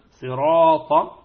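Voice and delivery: slow صراط with a heavy, emphatic ra; صراط بالتفخيم. Shown as a demonstration. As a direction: stretch out صراط with a thick ra. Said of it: "بالتفخيم". (صراط بتفخيم الراء ببطء)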